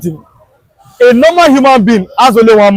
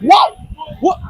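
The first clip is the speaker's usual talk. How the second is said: higher-pitched imitated astonishment; mock amazement; 5 semitones higher, 5 dB quieter than usual. Retelling everybody's reaction to his Biafra talk, mock amazement. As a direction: imitate a stunned crowd reaction.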